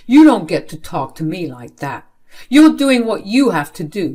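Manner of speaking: loud, emphatic parental telling-off; stern scolding. Acted. Father to child who talked back, stern scolding. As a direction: lay down the law, loudly.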